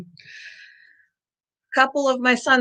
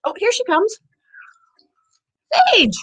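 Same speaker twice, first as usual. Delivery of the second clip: pet talk voice; 12 semitones higher than usual. Real, not acted. Dog approaching.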